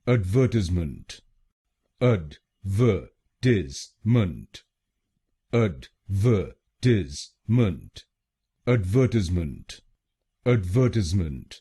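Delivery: एडवर्टाइजमेंट slowly as ad-VER-tiz-ment; British pronunciation. Shown as a demonstration. Teacher repeating the British word for learners, slow and clear. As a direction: say एडवर्टाइजमेंट with the stress on VER, some takes drawn out. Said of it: "तो बनेगा एडवर्टाइजमेंट".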